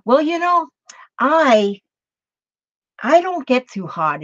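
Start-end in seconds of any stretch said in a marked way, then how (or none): none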